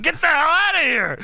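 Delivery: high, hammed-up mock yell; imitated hollering. Imitating a wrestler ordering the interviewer out, mock yell. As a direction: throw him out in a comic holler.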